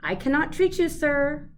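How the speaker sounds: loud high call of refusal; shouted. A narrator's reading. Mouse dentist shouting down a fox patient. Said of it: "Doctor DeSoto shouted".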